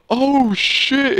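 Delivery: high squeaky oh shit; imitated oops. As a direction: blurt it like a caught kid. Friend realizes his mistake.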